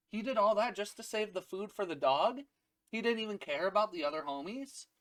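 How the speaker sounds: high, disbelieving questions; mock amazement. Voices Nami amazed, retold.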